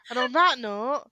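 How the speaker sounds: ordinary talk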